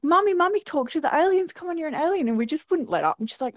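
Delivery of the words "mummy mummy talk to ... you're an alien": high, insistent mock little-kid voice; pestering child. Two young sisters won't let up on Mum, pestering. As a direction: imitate a kid nagging Mummy.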